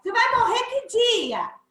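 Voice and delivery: loud, high, mocking careless chatter; rude casual. Shown as a demonstration. Interviewer mocks dying Maria, loud, high and tactless. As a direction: shout it like a tactless joker. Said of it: "Como é que eu vou falar assim".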